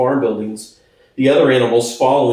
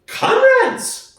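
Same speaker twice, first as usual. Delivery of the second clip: much higher, squeaky alarmed pig; nervous cry. Acted. Squealer calling the animals together, high nervous cry. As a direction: cry out like a jittery little pig. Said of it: "cried Squealer, making little nervous skips".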